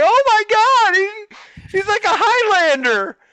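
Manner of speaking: very high, loud comic screech; retold shriek of horror; horrified shriek. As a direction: scream it in hammed-up disbelief. Acted horror as squirrel climbs again.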